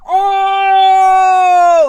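long, high, loud "ohhh" yell; crowd roar. Retold or imitated. Bar crowd reacting to a head kick, roaring. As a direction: roar like a whole bar at a knockout. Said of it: "everyone's like".

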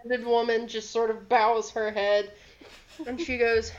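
ordinary talk, nothing marked

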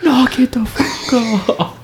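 slow, high, strained mock dad; Big Chris. Dad shouting at late-night pole banging, slow high mock telling-off. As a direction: stretch it out as an irate father. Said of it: "you hear Big Chris".

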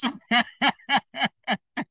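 long, high, real laughing fit; uncontrollable laugh. Real, not acted. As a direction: crack up helplessly mid-sermon. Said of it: "I'm trying not to laugh".

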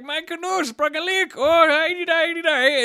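high-pitched, garbled foreign-sounding goofball; loud, drawn-out nonsense yodel of a cartoon adventurer; made-up adventurer voice. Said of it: "it's just my guy for this hypothetical".

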